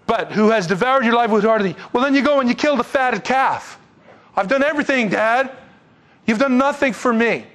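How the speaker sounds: high-pitched, forceful protest; indignant tirade. Acted. Older son says father did nothing for him, indignant. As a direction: vent a pile of grievances at Dad.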